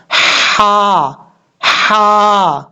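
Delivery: हा voiced from the throat as a thin breathy h; breathy ha sound. Shown as a demonstration. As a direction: demonstrate the throat हा. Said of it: "इस तरह का sound आएगा".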